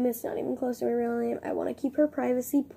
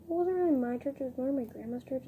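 ordinary talk, nothing marked

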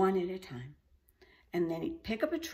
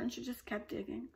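plain reading voice

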